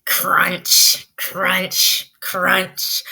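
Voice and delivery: crisp crunching snow noise; teacher demonstrating footsteps in snow; crunch sound. Shown as a demonstration. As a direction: model the crunch for the kids. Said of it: "Can you do that?".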